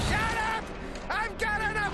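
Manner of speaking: high, exasperated yell; fed-up shouting. Acted. Man fed up with hecklers, exasperated shouting.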